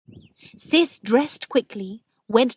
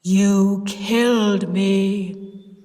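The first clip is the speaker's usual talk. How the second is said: very slow grave accusation; slow, louder ghost accusing again; deep voice; 5 dB louder, much slower than usual. A narrator's reading. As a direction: stretch "killed" like a ghost. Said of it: "Cis said in the deep voice".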